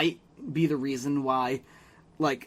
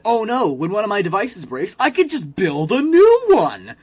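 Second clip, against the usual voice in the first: mock Jimmy realising; 5 semitones higher, 7 dB louder than usual. Imitating Jimmy's sudden realisation, high and loud. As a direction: play a boy genius's dopey revelation. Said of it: "Jimmy finally decides".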